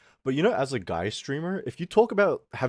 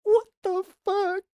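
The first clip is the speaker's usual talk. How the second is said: very high, slow squeak of disbelief; high-pitched exasperation; incredulous squeal; 5 dB louder, slower than usual. Real, not acted. Disbelief after his outburst.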